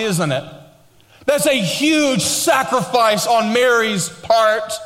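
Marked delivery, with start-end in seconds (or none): 1.3-4.7 preaching at full force. Acted. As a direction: lift it to a shout over the organ. Preacher peaks over music.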